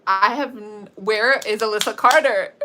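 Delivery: retold panic, building to a loud outburst; worked-up, ending in a loud demand; freaking out. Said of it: "Anaya starts freaking out".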